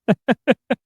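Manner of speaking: real high laugh. Real, not acted. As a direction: break into laughter at the bit. Reacting to the skit.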